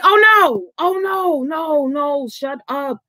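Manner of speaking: mock outrage that trails off; disbelieving protest dying down; exasperated no. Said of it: "I was so mad".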